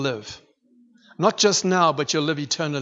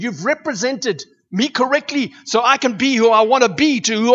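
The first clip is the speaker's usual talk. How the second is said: loud, higher-pitched preaching build; preaching at full force; 6 semitones higher, 4 dB louder than usual. Acted. Preacher exhorts the church about the word's power, fervently. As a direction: drive the point home to the congregation. Preaching, voicing God's words.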